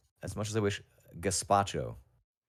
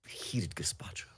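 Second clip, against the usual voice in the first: softly whispered; whispering; 3 semitones lower, 8 dB quieter than usual. Real, not acted. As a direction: breathe the words in a whisper. Muses on the phrase aloud.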